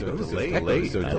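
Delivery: quick, fainter echo repeat of the line; a delayed echo of the line. Real, not acted.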